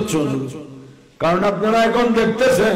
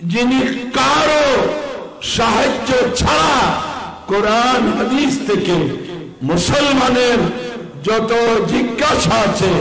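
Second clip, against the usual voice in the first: preaching; 4 semitones higher than usual. Acted. Preacher defines a true scholar.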